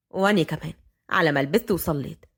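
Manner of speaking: low-pitched male figure, even; young man's voice. A narrator's reading. Fahd answers his grandfather, low and even.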